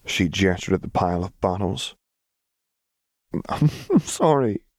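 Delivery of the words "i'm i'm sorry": high voice catching on a sob; tearful apology. A narrator's reading. Vi apologising to Caitlin after crying, tearful. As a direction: stumble through the apology, close to tears.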